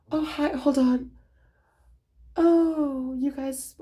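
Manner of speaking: high-pitched put-on surprised greeting; feigned surprise. Pretending to just notice the friends behind her, feigned surprise.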